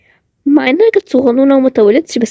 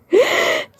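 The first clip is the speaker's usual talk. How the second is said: shocked gasp; 5 dB quieter than usual. Acted. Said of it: "بطلي تتصدمي".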